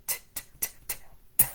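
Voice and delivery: crisp unvoiced t sound repeated; letter T sound. Shown as a demonstration. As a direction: tap out a clean t, t, t. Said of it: "And T says".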